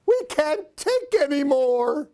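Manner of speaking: mock-panicked friends giving up; frightened cry. A narrator's reading. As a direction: beg to leave, panicking. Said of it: "My friends cry".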